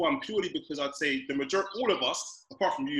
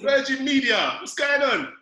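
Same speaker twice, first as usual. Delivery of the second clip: high, loud shout at the internet; frustrated shout; 8 semitones higher, 4 dB louder, slower than usual. Real, not acted. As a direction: yell at the broadband.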